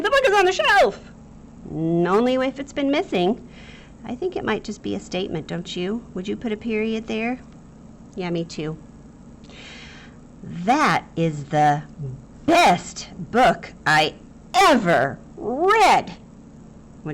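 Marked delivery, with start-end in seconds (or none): 0.0-1.1 much higher, loud over-thrilled read of "The book is on the shelf"; mock excitement. Shown as a demonstration. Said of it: "Is it exciting?". 10.4-16.1 slow, loud gushing; overacted excitement. Shown as a demonstration. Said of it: "I might have overacted it".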